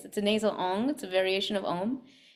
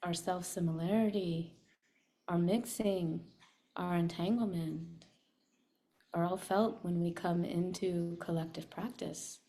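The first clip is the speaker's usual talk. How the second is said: guided meditation; 2 semitones lower, 6 dB quieter than usual. Acted. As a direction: guide them inward, softly. Leads a meditation.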